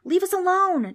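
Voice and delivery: put-on very high, loud girl's yell. A narrator's reading. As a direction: yell to be left alone. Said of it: "Trix yelled".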